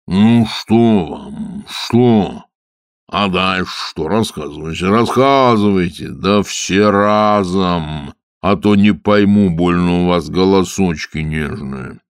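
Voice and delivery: very slow, stern rumbling; stern water spirit. Acted. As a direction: question them sternly and slowly. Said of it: "сурово спросил". (сурово)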